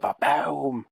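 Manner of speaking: drawn-out explosion noise; imitated explosion. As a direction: mimic a mirror blowing up with a big boom. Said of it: "sees the fucking mirror explode".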